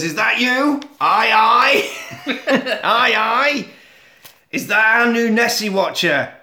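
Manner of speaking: a hint of a Scottish accent; lightly voiced Scottish radio caller. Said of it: "I'm not doing the accent".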